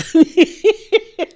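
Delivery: high, loud burst of laughter; loud cackle. Real, not acted. Host mocking Marisol's seventies outfit, loud high cackle.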